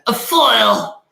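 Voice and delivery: high, slow put-on voice; a mock theatrical voice. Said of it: "Does it have to be said in that voice?".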